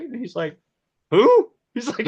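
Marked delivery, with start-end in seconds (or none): 1.1-1.5 high, loud shocked squawk; one-word startled yelp; shocked who. Retold or imitated. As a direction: blurt it in disbelief. DP shocked at strange crew.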